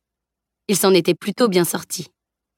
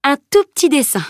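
higher, brushing it off lightly; shrugging it off, a little higher; downplaying; 8 semitones higher, 4 dB louder than usual. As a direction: make it sound like nothing. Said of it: "minimisa Camille".

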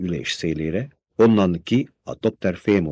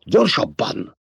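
higher, gasped out mid-run; panting; 7 semitones higher, slower than usual. A narrator's reading. Paul urging his mother on as the worm nears, panting. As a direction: pant the word while running. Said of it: "lihegte Paul".

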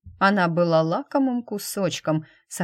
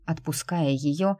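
ordinary delivery

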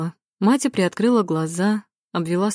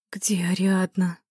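slow, weak whisper; slower than usual. A narrator's reading. Матя just out of a faint, asking in a whisper. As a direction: breathe the question out in a slow whisper. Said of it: "спросила она шёпотом".

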